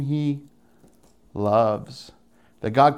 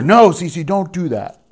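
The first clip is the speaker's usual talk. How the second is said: put-on louder, higher parent scolding a toddler; firm parental correction; 5 semitones higher, 6 dB louder, slower than usual. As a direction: check a child sharply, as an example. Correcting a child as example.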